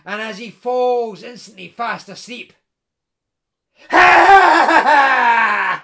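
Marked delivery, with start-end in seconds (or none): 3.9-5.8 a loud, booming evil cackle; evil laugh. A narrator's reading. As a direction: cackle like a triumphant bogle king. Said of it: "evil laughter goes up".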